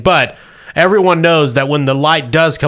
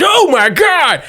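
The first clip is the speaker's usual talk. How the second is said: shocked cry; 4 dB louder, slower than usual. Acted. GM cries out at the fall.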